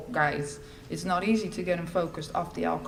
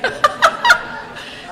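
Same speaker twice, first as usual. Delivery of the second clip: breathy high burst of laughter; real laugh breaking out; 8 dB louder than usual. Real, not acted. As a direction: crack up at the memory. Laughing at arms crossed.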